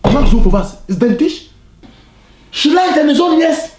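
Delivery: loud, high furious yelling; angry shouting. Acted. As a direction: yell it in outrage. Uncle shouting at parent.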